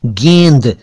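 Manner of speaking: "गेंद" as geynd; correct close vowel. Shown as a demonstration. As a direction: say "गेंद" with a narrow ay. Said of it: "गेंद नहीं".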